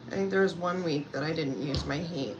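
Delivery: usual speaking voice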